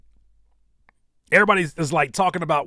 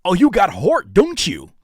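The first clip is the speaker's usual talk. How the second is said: loud, higher, brash dubbed-movie voice; movie voiceover impression; 4 semitones higher, 6 dB louder than usual. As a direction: belt it like a TV-edit dub. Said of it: "those voices".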